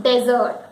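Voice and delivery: "dessert" as DEZ-ert; open, stressed first syllable in "dessert", DEZ-ert; wrong dessert. Shown as a demonstration. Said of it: "and not".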